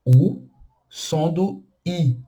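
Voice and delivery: slow U lips with an I sound; French u sound. Shown as a demonstration. Teacher demonstrates the French u vowel slowly. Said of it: "boca do".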